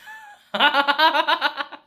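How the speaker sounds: long, loud, high laugh; real cackling laughter; big cackle. Real, not acted. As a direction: crack up after your own bit. Cracking up at own impression.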